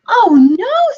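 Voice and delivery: high, loud, frightened scream. Acted. Piglet finding the fox at the door, screaming. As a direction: shriek in panic. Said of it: "screamed the piglet".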